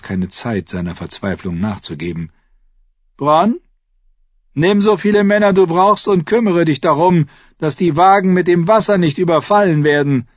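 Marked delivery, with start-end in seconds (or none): 3.2-10.3 higher, lightly voiced dwarf lord; Tyrion's voice. Tyrion answers the Hound about the horse, higher voice. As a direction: pitch up into Tyrion's quick voice.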